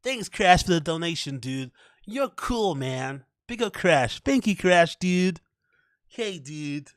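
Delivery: high, bouncy American streamer voice; hammed-up American streamer accent. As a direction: thank a donor like a hyped American streamer. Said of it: "American accent".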